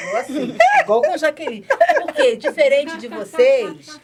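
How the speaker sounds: laughing loudly through the words; a real burst of laughter high and loud; giggling while recalling meeting Jaqueline. Real, not acted. Laughing while telling the story.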